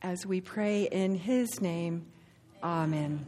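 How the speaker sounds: slow, measured prayer voice. Acted. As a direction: pray it slowly and reverently. Leads a prayer.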